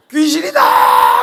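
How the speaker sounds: a shrieking high drawn-out cry of terror; terrified scream. Retold or imitated. 겁에 질린 제자가 귀신을 보고 비명을 지름. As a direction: scream it like you saw a ghost. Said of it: "이러면서 소리 질렀어요".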